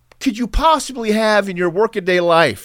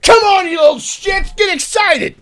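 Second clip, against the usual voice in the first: bossy dad; 10 semitones higher, 4 dB louder than usual. Retold or imitated. Dad voiced demanding excitement.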